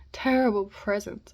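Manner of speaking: loud anguished cry. A narrator's reading. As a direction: call it out in despair. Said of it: "I cried".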